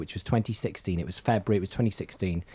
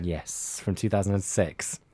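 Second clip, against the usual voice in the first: normal talking voice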